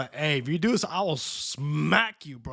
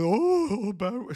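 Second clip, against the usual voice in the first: high-pitched mock dismay; mocking whine; 6 semitones higher than usual. Mocking the official who banned cursing, high scornful whine. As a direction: parody a scold in a raised voice. Mocks the cursing ban once more.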